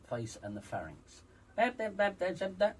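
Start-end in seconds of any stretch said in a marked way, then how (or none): 1.6-2.8 mock head-voice yapping, a little higher and louder; imitating Mark's head voice. Coach mocking how Mark talks, in a mild heady voice. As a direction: parody a thin voice stuck up in the face. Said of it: "talks like that".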